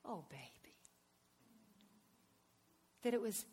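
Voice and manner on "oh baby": soft whispered endearment; hushed and full of love; loving whisper. Retold or imitated. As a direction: whisper it tenderly. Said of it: "was pretty much like".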